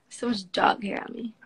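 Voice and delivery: usual speaking voice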